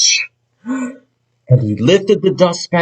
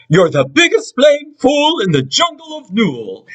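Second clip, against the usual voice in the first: sour kangaroo; 10 semitones higher than usual. Acted. Sour kangaroo introducing herself, high and haughty.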